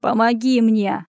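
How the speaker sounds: slow, strained croak. A narrator's reading. Waiter lying injured begs Anya, croaking. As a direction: croak out a plea for help. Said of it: "прохрипел тот".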